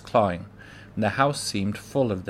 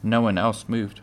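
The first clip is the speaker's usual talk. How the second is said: ordinary delivery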